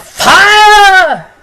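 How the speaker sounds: long, high, loud shriek; a drawn-out falling shriek of fire; general shriek. A narrator's reading. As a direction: shriek it like a panicking crowd. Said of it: "joined in a general shriek of".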